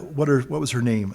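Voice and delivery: ordinary talk, nothing marked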